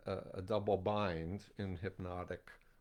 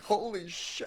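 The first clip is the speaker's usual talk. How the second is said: stunned; 10 semitones higher, 3 dB louder than usual. Retold or imitated. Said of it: "everyone who went around the room was like".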